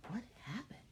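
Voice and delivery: high hushed whisper. Real, not acted. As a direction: whisper it like a mystery. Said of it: "asks kids, whispering".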